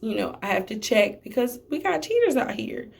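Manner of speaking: usual speaking voice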